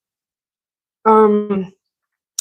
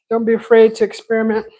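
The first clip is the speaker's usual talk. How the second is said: ordinary talk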